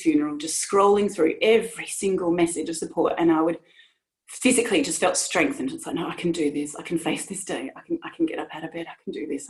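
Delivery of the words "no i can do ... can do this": low, hushed pep talk to yourself; murmured resolve, retold; quiet self-encouragement. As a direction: quicken and fade as you talk yourself into it.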